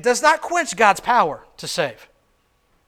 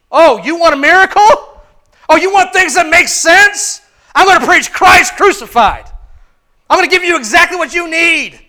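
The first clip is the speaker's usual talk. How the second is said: higher, louder retort; preacher's triumphant retort; fervent preaching; 8 semitones higher, 10 dB louder than usual. Acted. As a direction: fire it back at them. Preaching at full force.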